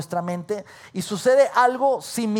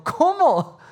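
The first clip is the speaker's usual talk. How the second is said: higher-pitched, startled question; incredulous reaction; 9 semitones higher, 4 dB louder than usual. Retold or imitated. Guest told the hotel is full, incredulous. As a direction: blurt it out in disbelief.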